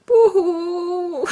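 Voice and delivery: high, loud hammed-up boo-hoo; mock boo-hoo sob. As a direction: fake sob for comic effect. Mock crying over lost video.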